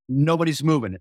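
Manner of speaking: ordinary talk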